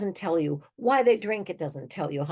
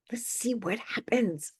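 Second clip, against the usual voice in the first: "Let's see what happens." whispered slowly and softer; 5 semitones higher, 3 dB quieter than usual. Real, not acted. Echoed as a slow whisper.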